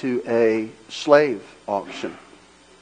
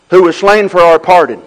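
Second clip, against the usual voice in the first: louder, rising preaching call; building preaching; 7 semitones higher, 11 dB louder than usual. Acted. Pastor building the refrain for the church, rising preaching. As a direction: start to lift the refrain.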